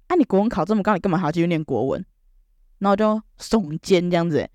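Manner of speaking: usual speaking voice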